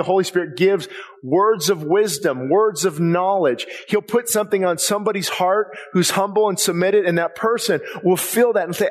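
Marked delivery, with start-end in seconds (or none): none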